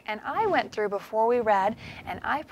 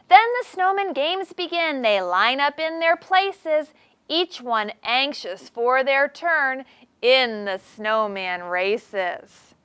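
lively, slow storybook reading; animated read-aloud for young kids; reading to children; 3 semitones higher, 3 dB louder, slower than usual. Acted. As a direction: read it out to the class like a picture book. Reads picture book aloud.

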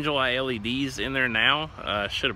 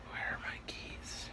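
whispering; 14 dB quieter, slower than usual. Real, not acted. Searching for keys, hushed.

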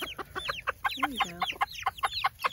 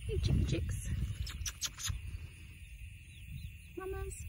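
soft chicken call; 7 semitones higher, 5 dB quieter than usual. Real, not acted. Calling the hens softly.

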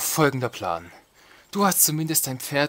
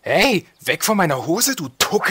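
indignant shout; 8 semitones higher, 5 dB louder than usual. Pretend. Shooing unit off his trousers.

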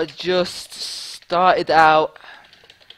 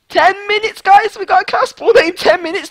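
high-pitched disbelieving squeal; thrilled, voice way up high; excited boast; 11 semitones higher than usual. Real, not acted. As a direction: squeal the news to your viewers. Boasting about getting spawner fast.

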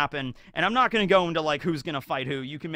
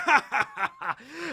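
hearty laugh; 9 semitones higher than usual. Retold or imitated. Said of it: "Garp's up there laughing".

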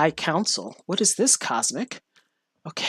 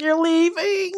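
squeaky voicing of a little cow, higher; 12 semitones higher, slower than usual. Voicing the little cow as they leave, high and squeaky. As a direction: give the cow a tiny, high voice. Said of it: "This little cow's like".

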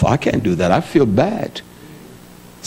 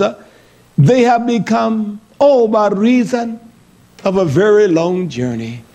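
high, slow, solemn reading of the verse; intoned scripture reading; 7 semitones higher, slower than usual. Acted. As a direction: lift the text up like holy writ, slow. Preacher reads scripture aloud.

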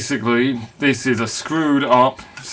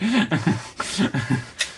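laugh; 5 semitones higher, 5 dB quieter than usual. Real, not acted. Laughs after mock threat.

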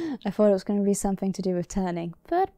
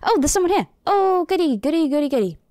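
high, loud gleeful chant; delighted someone's come; gleeful goody; 6 semitones higher, 6 dB louder, faster than usual. Real, not acted. A visitor arrives in game.

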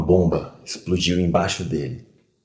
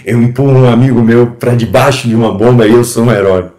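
loud, indignant disbelief; raised voice full of self-blame; bitter outburst; 4 semitones higher, 10 dB louder than usual. Acted. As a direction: throw the title back with scorn. Bitter outburst at being called hero.

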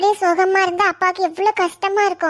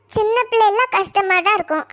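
normal talking voice

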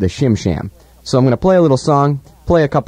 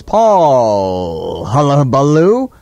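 grand slow name reveal; much slower than usual. Acted. Slow grand self-introduction.

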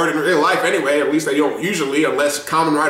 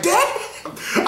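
laughing damn; 4 dB quieter than usual. Retold or imitated. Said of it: "I was like".

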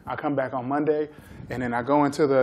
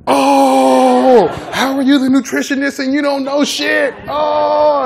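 high, loud taunting roar; mocking shout; 11 semitones higher, 12 dB louder, slower than usual. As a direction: clown her with a rowdy high jeer. Players mock the nutritionist loudly.

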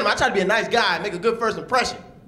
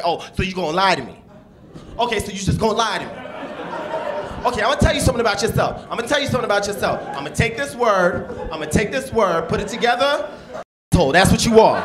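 high, fast, building to a loud blow-up; mock angry girlfriend; 6 semitones higher, 4 dB louder than usual. Comic as his ex in an argument, fast building loud rant. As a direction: rattle it off faster and louder. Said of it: "they kinda grab words".

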